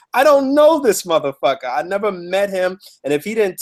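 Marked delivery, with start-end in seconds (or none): 0.1-1.6 pitched up in disbelief; high incredulous exclamation; exasperated outburst. Real, not acted. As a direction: blurt it out, baffled and annoyed. Exasperated rant about stranger.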